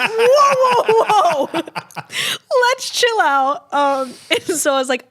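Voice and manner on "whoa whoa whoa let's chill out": high, loud laughing whoa, slowing down; half-laughing alarm, pitched way up. Retold or imitated. Telling the dentist to chill, laughing and loud. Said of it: "And I was like".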